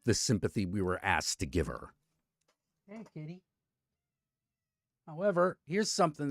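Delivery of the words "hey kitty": high, soft kitty call; cooing to the cat. Real, not acted. Streamer coos a greeting to his cat, high and soft. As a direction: coo sweetly at the cat.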